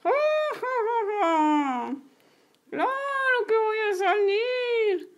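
high, slow cackling witch; villain Molly mocking the police, high and cackling. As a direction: taunt them like a gleeful old witch.

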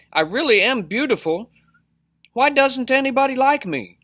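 high, loud plaintive whine; upset fish. A narrator's reading. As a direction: complain in a high, wounded voice. Fish pours out his troubles.

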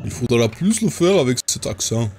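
put-on failed Russian accent. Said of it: "j'ai voulu faire un accent russe".

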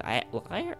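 "Atelier" guessed as "at-lie-er", rising into a question; tentative, questioning "Atelier"; unsure pronunciation. Real, not acted. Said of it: "tell me to pronounce this".

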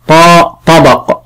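طاء طبق said slowly, طاء heavy and emphatic. Shown as a demonstration. Teacher sounding out طبق for the pupil, slow and careful. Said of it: "أشياء بها صوت طاء".

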